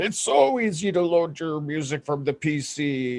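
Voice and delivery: high, put-on know-it-all fan voice; mocking iPhone users. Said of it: "comments from iPhone users going".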